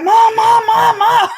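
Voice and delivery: high, loud panicked calls of Mom; hollering for mom. Retold or imitated. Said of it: "I was hollering for my mama".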